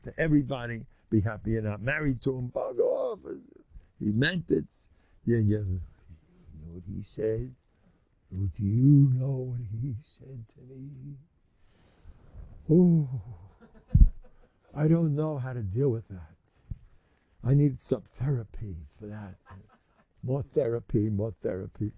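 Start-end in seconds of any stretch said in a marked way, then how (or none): 2.5-3.2 dismissive bug off. Shown as a demonstration. 5.6-19.4 mock hurt complaint.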